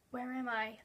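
soft, hushed question to oneself; whispered. A narrator's reading. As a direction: quietly wonder under your voice. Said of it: "even though I whispered it to myself".

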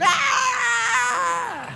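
long, very high held sung note; singing. Acted. Moses grabbing a microphone, cartoonish high singing. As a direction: belt out a cartoonish high wail. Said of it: "I mean, he was singing".

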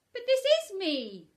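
high, loud, slow surprised protest. Acted. Pooh told he's gone visiting himself, surprised. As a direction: protest in astonishment. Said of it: "very much surprised".

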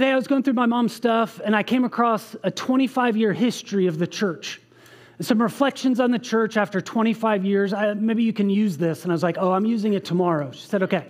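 usual speaking voice

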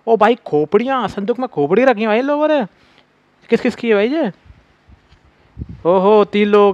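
high-pitched, astonished exclaiming; shocked discovery. Real, not acted. Player finding skulls in a chest, high shocked voice. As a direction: react aghast at a grisly find.

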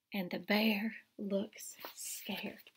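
soft, whispered and slow; hushed refrain. A narrator's reading. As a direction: let the fear hang in a whisper.

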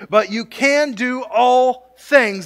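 slow, loud and high, leaning hard on can; "can" drawn out and pushed up high and loud; stress on can. Acted. Preacher to congregation, slow heavy stress on can.

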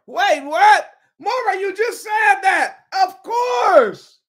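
high, loud, delighted outburst; excited shout. Real, not acted. Preacher shouting back at Maura, thrilled, high and loud. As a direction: shout out that she caught it. Caught out by his own words.

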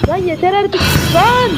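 high, loud outburst of exasperation; real frustrated yell; fed-up shout. Real, not acted. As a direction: snap out of patience at the game. Frightened by repeated scares.

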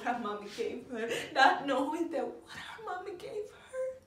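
high, crying voice with bursts of force; tearful breakdown. Acted. Mother grieving what the world does to her girl, tearful breakdown. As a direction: break down in sobs, gasping between phrases.

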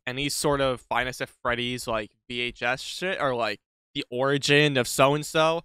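ordinary delivery

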